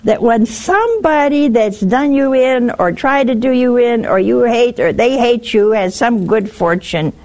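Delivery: ordinary talk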